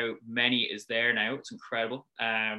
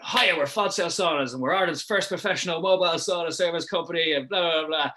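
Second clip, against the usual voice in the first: higher, louder, eager sales pitch; sales pitch mode; 6 semitones higher, 6 dB louder than usual. Retold or imitated. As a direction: sell it hard to a passer-by, bright and pushy. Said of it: "in full sales pitch mode".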